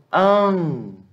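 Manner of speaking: "antro" with a long nasal AN; nasal sound demo. Shown as a demonstration. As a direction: hold the AN of antro in the nose. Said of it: "ele tem um som nasal".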